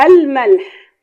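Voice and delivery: الملح shouted out like a teacher's question; emphatic teaching call. Shown as a demonstration. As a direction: punch الملح loudly to set the quiz. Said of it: "لام شمسية ولا قمرية؟".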